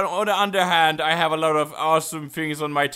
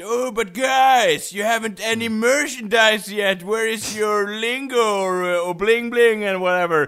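higher, whiny-customer plea; mock complaint; 3 semitones higher, 4 dB louder than usual. Fans asking about merchandise, mock complaining. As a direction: imitate a fan moaning about missing stuff. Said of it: "get some, uh, complaints about".

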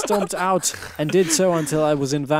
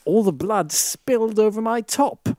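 ordinary talk

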